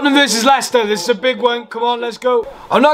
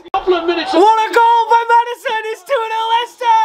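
goal celebration; 7 semitones higher than usual. Real, not acted. Second goal, fan celebrates.

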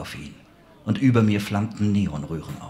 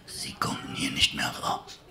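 weak whispering villain; 6 semitones lower, 8 dB quieter than usual. Acted.

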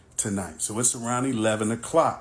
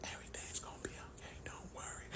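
breathy voiceless whisper, fast at first; hushed mock whisper of a sick wife; imitating a whisper; 22 dB quieter, faster than usual. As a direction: mimic the wife whispering through a sore throat. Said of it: "all this whispering".

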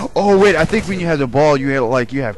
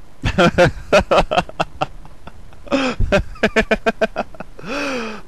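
giddy laugh; 8 semitones higher than usual. Real, not acted. Laughing after the win.

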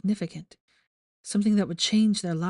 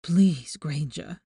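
begging; 2 semitones lower, much slower than usual. Acted. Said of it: "he begged".

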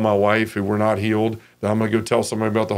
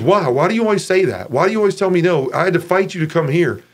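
higher, a touch of exasperation; why do you always refuse, mildly fed up; exasperated friend; 7 semitones higher than usual. Retold or imitated. Friend asks why he always says no, higher and mildly exasperated. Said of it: "And he goes".

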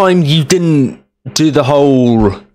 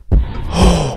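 sharp frightened gasp; startled gasp; 5 semitones higher than usual. Real, not acted. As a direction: catch your breath in shock. Player startled by the chase.

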